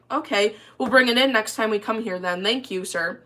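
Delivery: ordinary delivery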